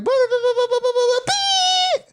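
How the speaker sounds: high-pitched gibberish babble; squeaky nonsense singing; mock hyperpop gibberish. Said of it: "And they're like".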